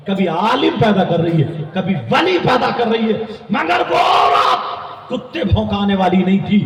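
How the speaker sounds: drawn-out cries rising to shouts; fiery preaching. Real, not acted. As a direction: preach with surging, stretched-out emphasis. Preaches at full force.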